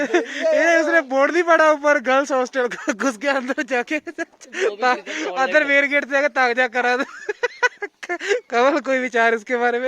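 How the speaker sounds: cracking up at his friend's blunder; laughing while telling. Real, not acted. To the viewers, about Ayush entering the girls' hostel, laughing hard.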